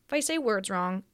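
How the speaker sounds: normal talking voice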